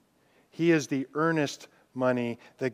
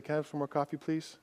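much softer, a small polite request; humble quiet ask; 9 dB quieter, much faster than usual. Retold or imitated. Preacher voicing a diner asking for a refill, quietly. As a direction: ask meekly, almost under your breath. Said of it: "pick up your cup and say".

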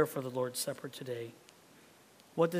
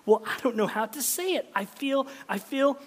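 higher, louder, bursting with joy; joyful cry; 8 semitones higher, 7 dB louder than usual. Acted. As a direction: let Sam's delight break out. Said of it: "he cried".

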